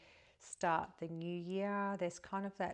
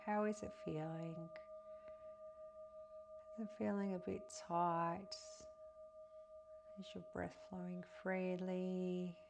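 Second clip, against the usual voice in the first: softer, calm guiding voice, phrase by phrase; guided meditation; 2 semitones higher, 6 dB quieter than usual. Acted. Guiding listeners through breath and a pier visualization, meditative.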